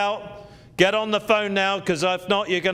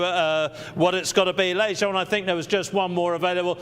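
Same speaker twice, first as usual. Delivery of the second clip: usual speaking voice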